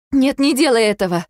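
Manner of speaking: high, loud panicked no; desperate plea. Acted. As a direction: stop him at all costs. Begging him not to call doctor.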